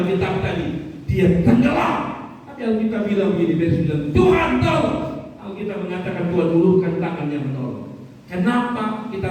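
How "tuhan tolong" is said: cry for help. Acted. Peter crying out, reread.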